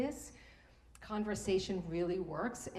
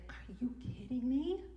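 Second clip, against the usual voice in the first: whispered thought; 5 semitones higher than usual. Retold or imitated. Inner thought as bully walks in.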